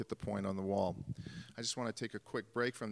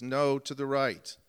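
calling out; 4 semitones higher, 8 dB louder, slower than usual. Retold or imitated. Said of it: "and Billy says".

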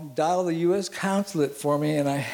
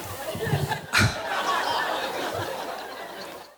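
real laugh. Real, not acted. Laughing at own story.

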